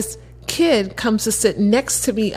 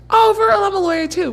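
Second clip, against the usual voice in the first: eager Josh; 11 semitones higher, 3 dB louder than usual. Retold or imitated. Josh excited.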